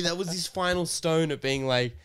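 ordinary talk

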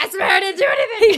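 high laughing mock protest; panicky denial. Caught guest pleading innocence to the captor, high laughing panic. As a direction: squeak out the excuse.

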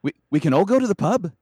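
high, loud bad Scouse; badly faked Liverpudlian, pitched high and loud; Liverpudlian accent. Shown as a demonstration. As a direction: push out a rough Liverpool accent. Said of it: "they're cringing for".

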